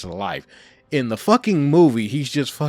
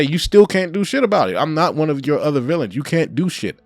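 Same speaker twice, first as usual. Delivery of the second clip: louder, higher taunting threat as Venom; menacing Venom taunt; 4 semitones higher, 4 dB louder than usual. Retold or imitated. Venom taunting Spider-Man to his face, loud menacing threat. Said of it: "and say".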